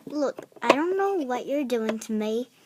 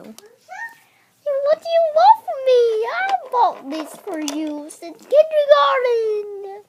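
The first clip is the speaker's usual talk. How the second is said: pleading whine; 8 semitones higher, 6 dB louder, slower than usual. Acted. Rejected kid pleads.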